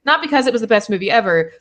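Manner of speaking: usual speaking voice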